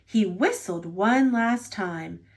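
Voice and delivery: ordinary delivery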